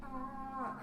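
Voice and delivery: high little "ah"; mock girly gasp. Acting out a woman using men.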